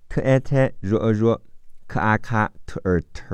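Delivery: normal talking voice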